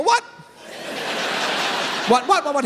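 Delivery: rising high, repeated what; mock confusion, high and laughing; high confused whats. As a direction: play dumb, pitch way up. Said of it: "I go".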